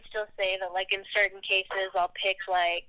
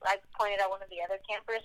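usual speaking voice

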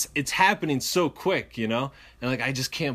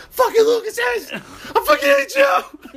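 very high, loud tearful scream; furious crying scream; 9 dB louder than usual. Retold or imitated. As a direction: scream it through tears at them. Beaten kid screaming at attackers.

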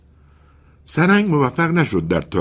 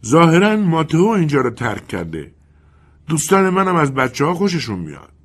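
Bernardi's voice; 9 semitones higher, 3 dB louder than usual. Acted.

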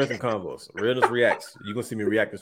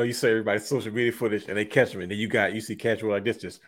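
ordinary delivery

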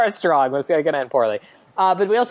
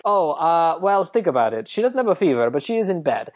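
put-on Italian accent, musing doctor; amateur Italian accent with a lilting tune; 3 semitones higher than usual. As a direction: play a very Italian doctor reasoning aloud. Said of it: "we get a very Italian doctor".

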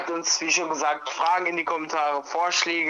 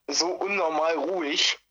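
ordinary talk, nothing marked

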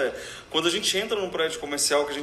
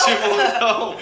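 laughing; 6 semitones higher, 9 dB louder than usual. Real, not acted. Host laughing joke.

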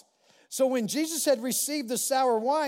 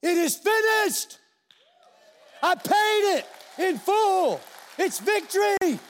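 triumphant; 7 semitones higher, slower than usual. Retold or imitated. Jesus on the cross declaring victory, slow triumphant proclaim. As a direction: proclaim it like a winner. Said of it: "No, he's like".

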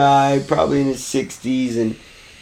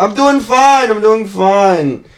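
imitating the schnitzel man; 6 semitones higher, 4 dB louder than usual. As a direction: sell it like a cheerful old man at a stand. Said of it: "He's like".

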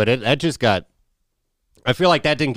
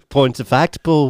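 louder Scouse quip; mock Liverpool accent; 4 semitones higher, 4 dB louder, slower than usual. Repeating [name]'s line to [name] in a Liverpool accent. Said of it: "kind of [name] on us".

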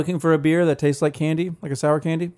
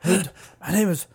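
bad German accent; 5 semitones higher than usual. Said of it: "Not German at all".

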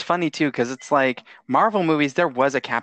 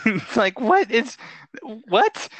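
high baffled what; bewildered; 9 semitones higher, much slower than usual. Retold or imitated. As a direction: squeak out the confusion. Mock bewilderment.

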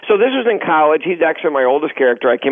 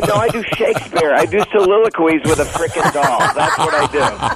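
sarcastic. Retold or imitated. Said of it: "that's exactly what I would say".

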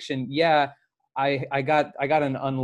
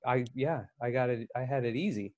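quiet realization; 2 semitones lower, 8 dB quieter than usual. Retold or imitated. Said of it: "and I'm like".

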